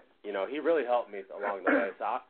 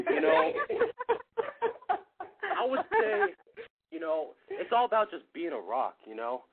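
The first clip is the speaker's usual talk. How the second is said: higher, slightly hammed-up jock voice; mock athlete interview. Answering the caller's sports question, mock athlete interview voice. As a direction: play the earnest athlete giving a locker-room quote. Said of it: "I'm gonna get my, the right mindset".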